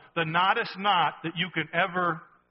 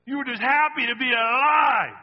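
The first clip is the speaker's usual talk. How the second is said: preaching shout; 5 semitones higher, 7 dB louder than usual. Real, not acted. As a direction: shout it out, higher and louder.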